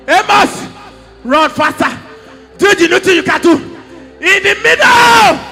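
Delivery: fervent prophetic preaching as the voice of God. Acted. Prophet voicing God to Amos, preaching fervently. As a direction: declare it like a prophet bringing God's word.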